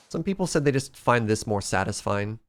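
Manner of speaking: usual speaking voice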